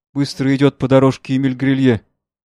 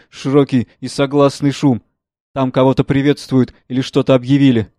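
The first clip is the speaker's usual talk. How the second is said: usual speaking voice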